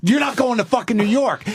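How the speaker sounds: high-pitched mock ultimatum; exasperated, pitch well up; retold yelling. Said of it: "we're both yelling at each other".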